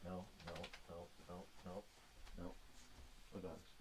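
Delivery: quiet, gentle no to the dogs; soft scolding. Real, not acted. Telling the dogs off the chair, softly. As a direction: hush them down softly.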